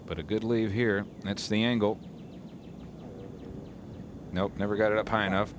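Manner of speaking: usual speaking voice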